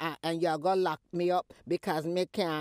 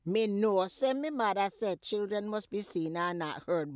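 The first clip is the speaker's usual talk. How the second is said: usual speaking voice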